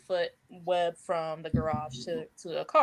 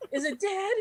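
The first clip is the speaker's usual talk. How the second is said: panicked worry; 10 semitones higher than usual. Retold or imitated. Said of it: "And I'm like".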